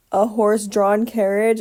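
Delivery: ordinary talk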